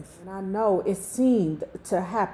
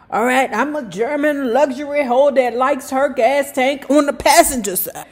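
loud, high, fast car gushing; excited; 5 semitones higher, 6 dB louder than usual. Real, not acted. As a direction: gush about the new car. Excited aside about new car.

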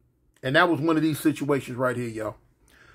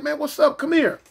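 calling out; 9 semitones higher than usual. Retold or imitated. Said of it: "I'm like".

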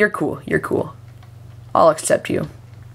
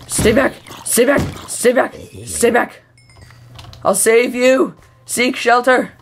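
high-pitched mock-heroic cries; hammed-up hero; 6 semitones higher than usual. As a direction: warn off monsters in playful, high-pitched alarm. Playing hero against monsters.

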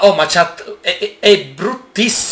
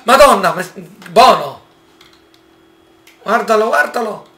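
high, loud frightened cry; scared exclamation; 6 semitones higher, 7 dB louder than usual. Real, not acted. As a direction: yelp at the raptor in fright. Scared by the raptors.

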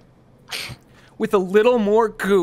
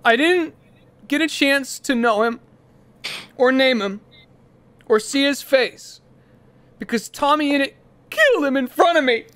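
hammed-up eulogy climbing higher toward fake grief; mock funeral eulogy; 7 semitones higher than usual. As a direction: play a grieving parent at a funeral, overdoing it.

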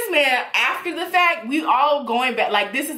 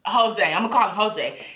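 normal talking voice

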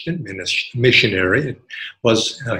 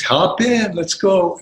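cheerful driver invite; 7 semitones higher, 5 dB louder, slower than usual. Retold or imitated. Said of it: "he says".

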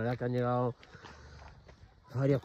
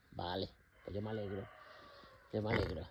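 much softer, lower, gentle; tender murmur; 5 semitones lower, 15 dB quieter than usual. Real, not acted. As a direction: murmur it fondly to the animal. Softly answering her pet. (murmullo tierno y suave)

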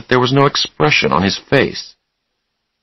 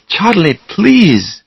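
high pleading call of his name; 11 semitones higher, much slower than usual. Acted. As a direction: beg him to stop, voice lifted. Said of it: "Susanna said".

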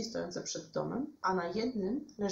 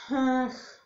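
loud, higher sighed groan; exasperated sigh; 7 semitones higher, 7 dB louder than usual. A narrator's reading. Witek turns down Lilka's painting idea, sighing. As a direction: heave a big vocal sigh. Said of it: "westchnął Witek".

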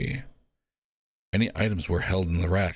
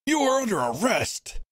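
much higher, angry accusing shout; furious warden. Acted. Warden condemning the prisoners, angry shout. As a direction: condemn them all.

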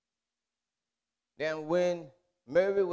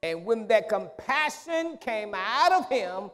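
loud, high climactic preaching; preaching at full force; 6 semitones higher, 10 dB louder than usual. Acted. Preacher to congregation about compassion, full force. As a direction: shout it home to the church.

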